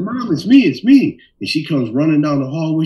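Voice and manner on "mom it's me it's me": much higher and louder, urgent call. Retold or imitated. Son calling to his startled mother, high loud urgent voice. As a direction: reassure a screaming mother fast.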